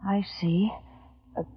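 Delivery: breathy shocked whisper; stunned hush. Acted. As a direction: barely breathe the words. Shocked at the news.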